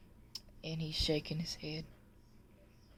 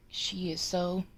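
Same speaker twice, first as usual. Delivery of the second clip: usual speaking voice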